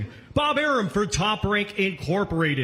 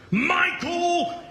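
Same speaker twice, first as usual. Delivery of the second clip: slow, stretched, higher-pitched name; grand introduction; 5 semitones higher, much slower than usual. Real, not acted. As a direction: present him like the main event. MC introduces the star announcer.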